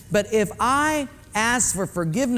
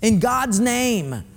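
high, sharp, annoyed snap; irritated get off my back; 6 semitones higher than usual. Retold or imitated. Husband snapping at his nagging wife, high and annoyed. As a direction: push them away, annoyed. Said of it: "a religious way of saying".

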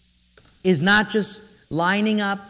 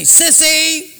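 a loud mocking "Sissy!" from a running partner; taunting shout; 9 semitones higher, 8 dB louder than usual. Running partner taunting a slacker, loud mocking jeer. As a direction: jeer it high and loud like a rival. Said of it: "he's gonna say".

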